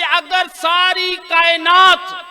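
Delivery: slow, high, loud declaration; preaching at full force. Acted. Preacher proclaiming the imam's saying to the gathering, full force. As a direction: ring out each word to the hall.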